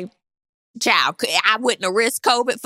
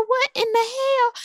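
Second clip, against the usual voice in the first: incredulous; 14 semitones higher than usual. Retold or imitated. Reacting to the stretching.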